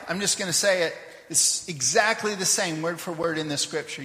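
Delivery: usual speaking voice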